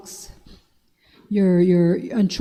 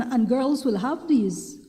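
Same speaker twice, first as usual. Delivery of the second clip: usual speaking voice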